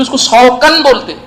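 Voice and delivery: "سوکن" pushed high in correction; insistent correction. Retold or imitated. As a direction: correct the listener, lifting "سوکن" well above the rest. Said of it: "نہیں، بولے".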